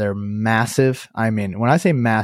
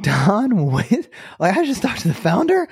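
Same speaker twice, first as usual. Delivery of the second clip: astonished disbelief; 9 semitones higher than usual. Retold or imitated. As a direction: pitch leaping way up into a question. Retelling discovery of who called.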